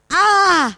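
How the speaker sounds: high loud yelp thrown back by the mountains; echoing pained ow; echoed cry. A narrator's reading. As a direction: cry out again like an echo. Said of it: "die schreit auch".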